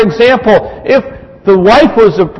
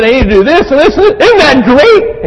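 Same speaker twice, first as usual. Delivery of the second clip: eager boast; 8 semitones higher than usual. Retold or imitated. Husband boasts dad's fix to wife.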